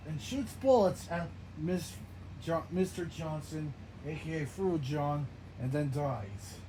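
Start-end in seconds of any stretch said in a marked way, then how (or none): none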